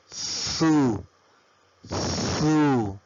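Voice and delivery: Z as a drawn-out "zee"; American letter Z. Shown as a demonstration. Teacher demonstrating American English, letter Z said "zee". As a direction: buzz into "zee", the American name of Z. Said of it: "en inglés americano se pronuncia".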